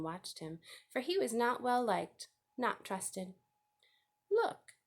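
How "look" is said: high sharp rat call. A narrator's reading. Templeton the rat challenges the goose, sharp voice. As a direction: snap it out like a sly rat. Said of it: "in his sharp voice".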